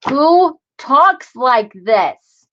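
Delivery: very slow, stretched-out disbelief; emphatic word-by-word bafflement; incredulous. Real, not acted. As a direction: drag out each word, high and baffled. Baffled again by the old wording.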